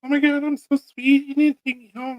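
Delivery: high sugary kitty voice; cat voice. Retold or imitated. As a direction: play the cat as sweet and eager to be adopted. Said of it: "She was like".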